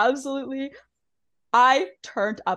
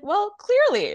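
high, loud mock retort; mock comeback, pitched up and louder; retold retort; 11 semitones higher, 6 dB louder than usual. Retelling Katie's comeback in the argument, high mock retort.

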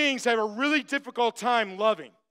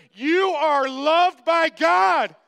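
louder, very slow declaration; proclaimed; 5 semitones higher, 6 dB louder, much slower than usual. Real, not acted. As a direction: proclaim it slowly with full force. Preacher proclaiming the key line.

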